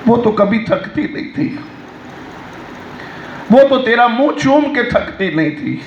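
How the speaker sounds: ordinary talk, nothing marked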